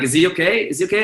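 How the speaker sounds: high-pitched worried security guard; repeated mock-anxious query; concerned questioning. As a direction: imitate an official checking on someone, voice up. Security concerned about carried man.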